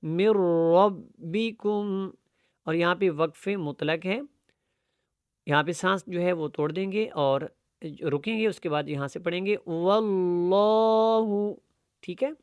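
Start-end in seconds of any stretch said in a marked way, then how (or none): 0.0-2.1 slow "mir-rabbikum" with heavy r in ربکم; stretched recitation, من merged into a full r; thick ra. Shown as a demonstration. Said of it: "را جو ہوگا وہ موٹا ہوگا". 9.7-11.5 slow, held "Wallahu"; measured model reading; Quran recitation. Shown as a demonstration. Teacher demonstrates recitation after a pause.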